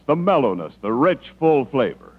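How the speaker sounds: ordinary talk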